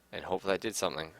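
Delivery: ordinary delivery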